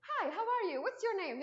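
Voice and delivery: rote learner phrases. Retold or imitated. Moroccan teen reciting generic English phrases, sing-song. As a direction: recite the stock phrases like a lesson. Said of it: "this generic, like, you know, these phrases".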